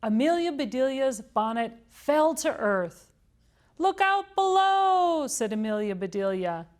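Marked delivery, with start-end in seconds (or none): 3.8-5.3 high, slow, loud call; warning call. A narrator's reading. Amelia Bedelia as her bonnet falls, calling out.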